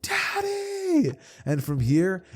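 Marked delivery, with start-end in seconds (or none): 0.0-1.1 put-on high, piercing child cry of Daddy; child's scream. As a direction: scream it like a terrified little girl. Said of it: "as Eri would scream".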